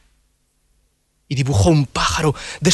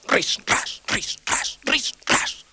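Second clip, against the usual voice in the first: whispered scraping sound; 10 semitones higher, 4 dB quieter, much slower than usual. Acted. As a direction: scrape the words like a file. Sonido de lima. (ris ras susurrado)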